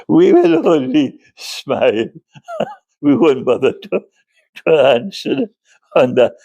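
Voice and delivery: words half lost in a laugh; trying to speak through the giggles. Real, not acted. Lecturer apologising to students, speaking through real laughter. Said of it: "forgive me for laughing".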